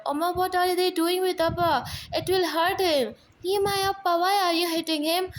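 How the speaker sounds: put-on high, pleading little child's voice; a small scared child asking; frightened child. Child asking mother about father's treatment, frightened and high.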